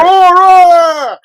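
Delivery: high, loud call across a distance; high carrying shout. Pretend. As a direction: yell the name out to find her. Calling out a name again.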